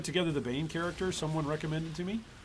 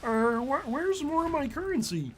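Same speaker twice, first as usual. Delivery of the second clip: much higher, strained Kermit-style puppet voice; 13 semitones higher, 5 dB louder than usual. Retold or imitated. Streamer mocks a greedy guildmate as Kermit, high puppet squeak. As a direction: squeeze it up high like Kermit the Frog. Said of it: "But I'm using my Kermit voice".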